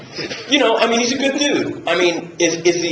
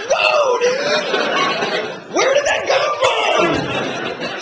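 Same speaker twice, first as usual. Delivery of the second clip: much higher, very slow laughing shout of surprise; startled shout; much slower than usual. Retold or imitated. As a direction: yelp it out like you've been ambushed by a line. Startled reaction to an odd line.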